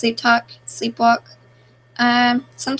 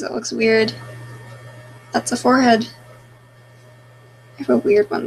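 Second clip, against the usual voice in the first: normal talking voice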